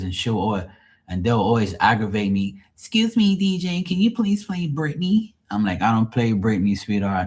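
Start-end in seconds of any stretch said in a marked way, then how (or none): 2.7-5.3 put-on high, dopey girly request. Rich young girl pestering the DJ for [name], high mocking voice. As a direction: imitate a spoiled girl, pitched way up.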